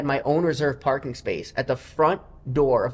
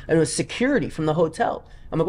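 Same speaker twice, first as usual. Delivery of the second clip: ordinary talk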